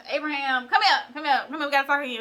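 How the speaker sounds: high, loud impatient summons as Sarah; calling out as Sarah. Retold or imitated. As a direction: call him over, fed up and insistent. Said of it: "Sarah was like".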